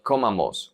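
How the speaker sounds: "comamos" wrongly stressed at the start, CO-ma-mos; wrong first-syllable stress. Shown as a demonstration. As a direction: hit the CO in "comamos". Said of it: "not comamos or comamos".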